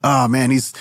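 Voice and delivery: ordinary talk, nothing marked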